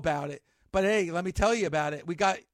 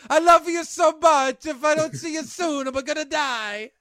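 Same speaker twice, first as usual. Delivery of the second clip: Godfather Italian voice; 7 semitones higher, 5 dB louder than usual. Retold or imitated. Quoting Clemenza from The Godfather, in a loud mock Italian accent. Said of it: "it's for The Godfather".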